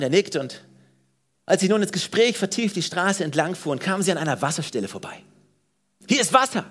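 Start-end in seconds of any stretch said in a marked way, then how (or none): 6.1-6.6 mild, higher-pitched exclamation; calling out. A narrator's reading. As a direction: call it out a little, pitched up. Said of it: "rief der Äthiopier". (leicht erhöhter Ausruf)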